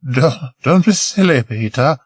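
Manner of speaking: a hint of Marie, coaxing; lightly voiced young woman. Marie coaxing Peter to come below, lightly voiced woman.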